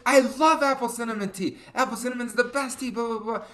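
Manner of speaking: high-pitched gushing kid imitation; hammed-up excited child; freaking out. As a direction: gush at the top of your range like an excited child. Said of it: "Ellie starts freaking out".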